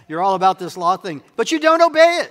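heated accusation, high and loud; high-pitched loud challenge; loud confrontation. Acted. Acted quarrel, Jesus confronting accusers.

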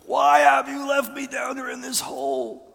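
high, loud screaming at God. Retold or imitated. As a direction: scream it with all you've got. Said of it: "screamed my guts out".

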